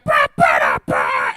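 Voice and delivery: very low, loud hammed-up grunting bah; wrestler's battle yell. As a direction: bellow like a wrestler off the top rope. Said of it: "climbed on the third rope and was like".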